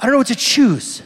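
hammed-up overwhelmed customer; mock indecisive diner. Shown as a demonstration. Preacher mimicking himself lost at a menu, mock dithering. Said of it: "Like, I don't know what to choose".

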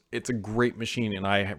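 normal talking voice

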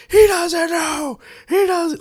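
high, slow, loud imitation of a man losing it; hammed-up high furious rant; mock raging. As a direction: act out the actor going nuts. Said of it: "he goes nuts".

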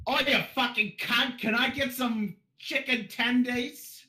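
butcher an Australian accent ordering food; bad Australian accent. Shown as a demonstration. Ordered to demo his worst Australian accent at McDonald's. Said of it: "we call that voice Outback Liler".

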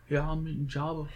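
lightly voiced, bad celebrity impression; botched impression played for a laugh; Woody Harrelson. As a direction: badly imitate a film star, a little softly. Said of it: "impersonating Woody Harrelson".

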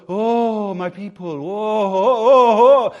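hammed-up prophetic wail, swelling loud on the last oh; mock weird prophet voice. Preacher mocking prophets who put on a weird voice, hammed-up wail. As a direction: ham up a spooky oracle voice, mocking fake prophets. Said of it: "And I'll put on a weird voice".

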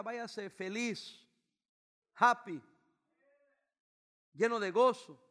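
slow then loud burst of joy; drawn-out feliz, then a loud happy; joyful exhortation. Real, not acted. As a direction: send them off rejoicing.

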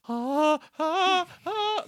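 panicked, very high loud yelps; mock freak-out in rising little cries; Pearl. Pearl watching the house get damaged, panicked yelps. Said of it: "Pearl's just like".

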